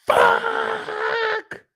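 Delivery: very high anguished yell; pained shout. Pretend. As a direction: cry out in shock at the loss. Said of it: "seen that three million gone and said".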